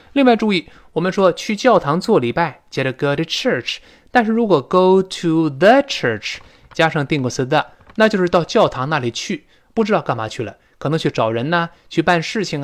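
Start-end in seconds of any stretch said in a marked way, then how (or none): none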